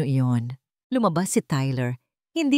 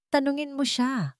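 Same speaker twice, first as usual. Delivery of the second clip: higher, taunting dare; a pitched-up taunting challenge; challenging; 8 semitones higher, 3 dB quieter than usual. A narrator's reading. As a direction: throw it down as a challenge. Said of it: "hamon ni Tyler".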